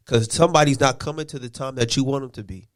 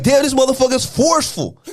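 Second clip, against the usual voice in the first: mock complaining wife; 9 semitones higher than usual. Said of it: "You gonna be like".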